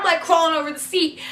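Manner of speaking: ordinary talk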